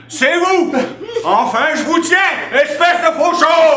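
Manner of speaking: yelling in triumph at the rival; furious shout. Acted. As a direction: grab him and shout. Husband confronts the official.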